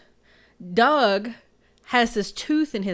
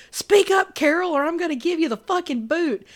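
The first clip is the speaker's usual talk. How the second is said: higher and louder, a hammed-up pimp barking orders; mock yell; 8 semitones higher, 6 dB louder than usual. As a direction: bark it like a bossy pimp, half joking. Retold pimp yelling at Carol.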